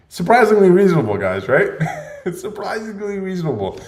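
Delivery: loud, high, laughing emphasis; cracking up at the punchline; amused outburst. Real, not acted. Joking about Amsterdam prices.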